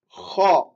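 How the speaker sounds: Kha growled low and loud from the throat; little roar, low sound. Shown as a demonstration. Teacher models Kha as a low roar for repetition. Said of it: "Un petit rugissement et avec un son grave".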